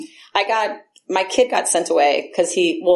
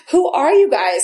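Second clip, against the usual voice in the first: higher, a bit louder, happily astonished; can't believe how kind they are; delighted amazement; 6 semitones higher, 3 dB louder than usual. Retold or imitated. About friends offering coffee, higher amazed question.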